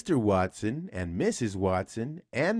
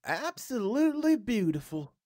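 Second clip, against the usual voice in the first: higher put-on voice for the husband; Mister Watson's voice; 12 semitones higher than usual. A narrator's reading. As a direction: voice him higher than the narrator. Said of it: "said Mister Watson".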